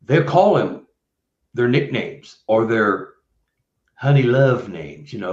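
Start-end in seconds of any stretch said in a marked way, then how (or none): none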